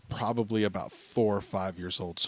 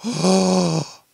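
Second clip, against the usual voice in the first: loud, higher gasp and Oh!; mock shocked gasp; 9 semitones higher, 9 dB louder than usual. Dad feigning alarm at his daughter's bottom, loud mock gasp. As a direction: gasp like you just saw something shocking. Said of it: "and I go".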